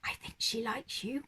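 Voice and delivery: soft, breathy whisper. A narrator's reading. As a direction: whisper it as a secret. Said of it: "whispered Judy".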